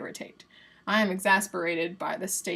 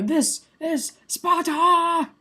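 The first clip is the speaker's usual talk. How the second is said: loud, high, drawn-out movie-hero shout; stretched-out imitation war cry; mock battle cry; 9 semitones higher, 7 dB louder, much slower than usual. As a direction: bellow it like a hammed-up warrior. Quotes a famous movie battle cry.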